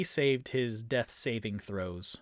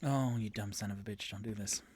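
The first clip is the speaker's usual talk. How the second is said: low, soft grumble under the breath; muttered dismay; 4 semitones lower, 8 dB quieter, faster than usual. Real, not acted. Game master dreading Rainier's move, muttering low. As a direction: mutter it to yourself, quickly sinking away.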